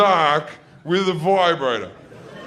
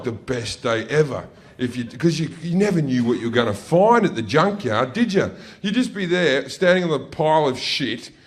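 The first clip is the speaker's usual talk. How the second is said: ordinary talk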